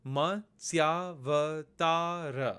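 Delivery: Ma, tsya, va, ta, ra counted out one at a time; syllable by syllable. Shown as a demonstration. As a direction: tick off each syllable with a pause. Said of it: "a total of five syllables".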